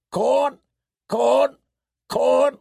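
high, repeated squawks; harsh piercing raven calls; talking raven. A narrator's reading. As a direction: squawk each word like a hungry bird. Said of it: "the raven said darkly".

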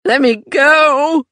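high, loud terrified scream. Acted. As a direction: scream to break free. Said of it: "Joey screamed".

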